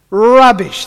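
loud, high declaration Rubbish; emphatic rubbish. Shown as a demonstration. Pastor repeats it loudly to the church. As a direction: bellow Rubbish.

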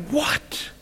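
hushed, high-pitched astonished question; stunned disbelief. Acted. Onlooker seeing the widow's son alive, hushed astonishment. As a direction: gasp it softly in disbelief.

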